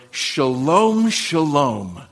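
"Shalom, shalom" said twice, each word given full weight; emphatic repetition. Shown as a demonstration. Preacher shows Hebrew emphasis by repetition, stressing "Shalom, shalom". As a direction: repeat "Shalom, shalom" deliberately for emphasis. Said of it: "they would repeat themselves".

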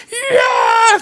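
high, loud shouted war cry; rally the charging army; battle cry. Acted. Army charging out, a loud battle cry.

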